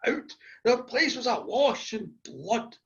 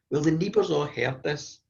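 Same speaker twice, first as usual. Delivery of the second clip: ordinary delivery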